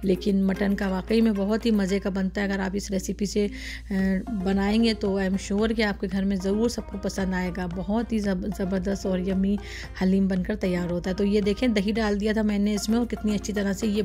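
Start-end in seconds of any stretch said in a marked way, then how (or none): none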